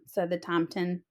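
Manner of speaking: ordinary delivery, nothing marked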